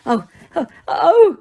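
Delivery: gulping cries rising high and loud; gulped. A narrator's reading. Mrs Weasley gulps in shock, rising high and loud. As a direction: choke back sobs. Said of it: "gulped Missus Weasley".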